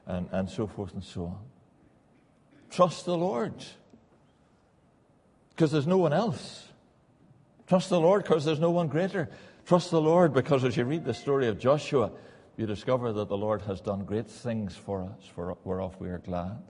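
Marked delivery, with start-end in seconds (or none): 2.7-12.1 preaching at full force. Acted. Preacher urging the church to trust the Lord, at full force. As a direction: press the trust home to the congregation.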